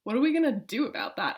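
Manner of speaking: usual speaking voice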